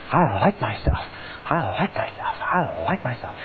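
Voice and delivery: felt affirmation. Shown as a demonstration. Coach showing the right way, softly and felt. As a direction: breathe feeling into I like myself. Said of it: "You say".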